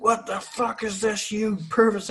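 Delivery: high-pitched, drawn-out mock outrage; losing his shit. Sailor finding a prank under his pillow, mock outraged squeal. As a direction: imitate a man blowing up, voice way up. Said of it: "he fucking loses his shit".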